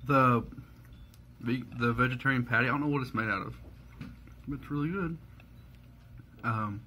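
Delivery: talking between bites of burger; muffled speech with food in mouth; mouth full, chewing. Real, not acted. Seen 0: Holds burger, takes bites, cheeks and lips show chewing while speaking.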